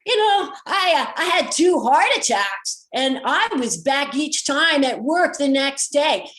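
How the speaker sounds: loud, slow boast over the wind; talking loudly. Retold or imitated. Tow driver shouting over the open windows, loud. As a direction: project over rushing air from open windows. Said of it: "he's talking really loudly to be heard over the air".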